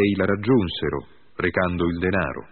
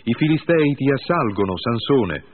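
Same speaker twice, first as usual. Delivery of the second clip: high, loud cry of alarm; shouted warning; 7 semitones higher, 4 dB louder than usual. A narrator's reading. Woman shouting to rouse her sleeping lover, loud alarm. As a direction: call out sharply as if danger is at the door. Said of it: "e poi gli gridò".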